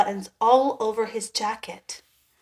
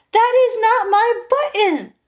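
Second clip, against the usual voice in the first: much higher, louder angry shout; 12 semitones higher, 9 dB louder than usual. A narrator's reading. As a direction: Toad shouts in frustration at Frog. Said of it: "shouted Toad".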